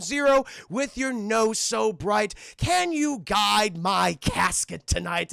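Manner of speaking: slow, theatrical proclamation; retold heroic appeal, drawn out; grandiose Jack. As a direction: stretch each word like a stage plea. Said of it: "Jack goes to him".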